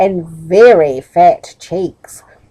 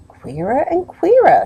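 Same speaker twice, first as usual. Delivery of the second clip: puzzled; 8 semitones higher than usual. Acted. Plod puzzled.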